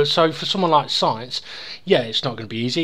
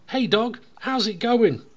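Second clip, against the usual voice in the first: raised-pitch greeting to an animal; joke's Englishman; 7 semitones higher than usual. Acted. Englishman greets the Welshman's dog, higher chatty greeting.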